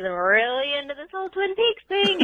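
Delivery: a hint of a caller impression, pitch climbing; lightly imitated Lydia. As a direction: lightly mimic a caller, lifting the pitch to the end. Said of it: "I love how she says".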